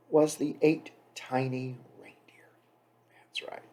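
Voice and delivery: hushed playful reveal; mock secret whisper. Dramatic hushed reveal of reindeer.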